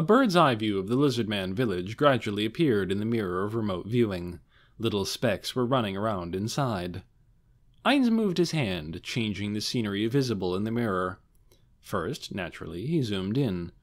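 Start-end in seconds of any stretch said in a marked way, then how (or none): none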